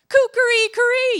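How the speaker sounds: shrieking high, loud rooster crow; crying out. A narrator's reading. Rooster back at the sultan's window, crying out. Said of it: "cried out".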